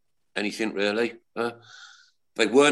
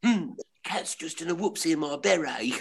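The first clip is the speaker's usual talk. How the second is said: higher whiny hapless voice; Frank Spencer; 5 semitones higher than usual. Retold or imitated. Panel impression of Frank Spencer, higher and whiny. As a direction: bleat it like a hapless fool. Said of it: "Frank Spencer".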